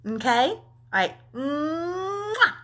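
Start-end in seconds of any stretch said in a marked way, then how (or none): 1.3-2.5 long, high rising mwah; big exaggerated smooch to sign off; drawn-out hum ending in a kiss smack; blown kiss. Real, not acted.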